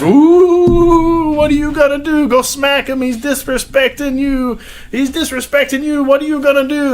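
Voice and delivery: very high, loud taunting voice; mocking Conor taunt. Conor taunting Khabib at the press conference, high mock. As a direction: goad him in a shrill Irish mock. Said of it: "Conor's like".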